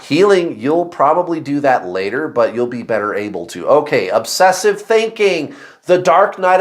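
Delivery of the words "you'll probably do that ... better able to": usual speaking voice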